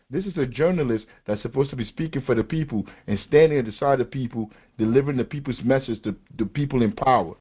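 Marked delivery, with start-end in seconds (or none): none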